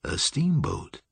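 slow, savoured wondering; musing. A narrator's reading. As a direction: draw it out as you ponder. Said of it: "mused Damon Julian".

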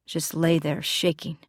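ordinary delivery

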